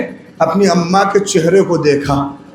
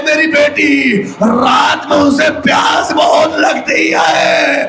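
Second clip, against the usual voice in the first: tearful bequest; 6 semitones higher than usual. Acted. Dying mother to daughter about her brother, wailing. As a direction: cry out the last wish.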